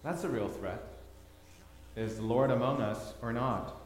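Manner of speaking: ordinary delivery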